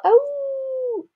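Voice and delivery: long high held howl; mock wolf howl. Parent howling like the wolf for Kara, long mock howl.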